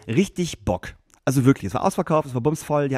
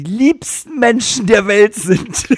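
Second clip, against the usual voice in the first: much higher, louder, slow gush; gushing praise; 9 semitones higher, 9 dB louder, much slower than usual. Real, not acted. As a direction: oversell it sweetly. Gushing exaggeration about audience.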